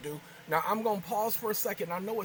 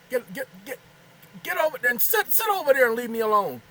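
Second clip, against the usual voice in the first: loud, higher shoo at the kitten; shooing the cat; 6 semitones higher, 8 dB louder, faster than usual. Real, not acted. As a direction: chase the cat off, exasperated.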